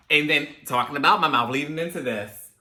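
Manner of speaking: normal talking voice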